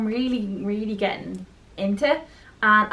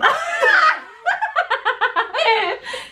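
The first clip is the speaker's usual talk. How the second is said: burst of laughter. Real, not acted. Laughs at own attempt.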